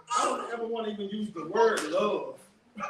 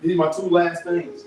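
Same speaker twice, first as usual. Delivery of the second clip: ordinary talk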